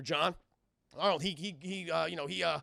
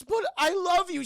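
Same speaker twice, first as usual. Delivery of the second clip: high, loud, drawn-out whine; mock plaintive plea; 13 semitones higher, 8 dB louder than usual. [name] answering his berating mother, exaggerated pleading. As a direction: plead back at a scolding mother.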